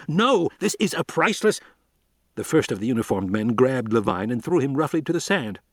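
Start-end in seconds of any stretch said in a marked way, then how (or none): 0.1-1.6 high, pleading shout. A narrator's reading. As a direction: cry out to save the specimen. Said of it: "He shouted".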